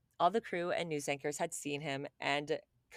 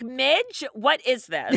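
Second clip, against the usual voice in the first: loud, higher mock bewilderment; baffled viewer; 8 semitones higher, 11 dB louder, much slower than usual. Host acts a viewer watching at home, baffled and loud. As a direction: exclaim it like a confused TV watcher.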